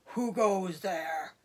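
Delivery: slow, low challenge from above, only lightly voiced; a hint of a deep stranger calling down. Unseen badger calls down into tunnel, slow and lightly deep. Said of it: "a deep voice".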